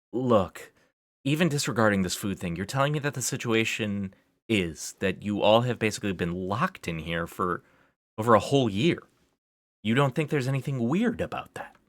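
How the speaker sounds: exasperated questioning after a heavy sigh; worked up. Acted. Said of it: "got a bit worked up".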